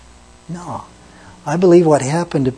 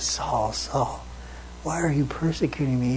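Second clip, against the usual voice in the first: softer, hushed plea; a quiet, wounded call; heartbroken; 11 dB quieter than usual. Retold or imitated. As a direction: breathe his name in sorrow. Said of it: "he was heartbroken over that".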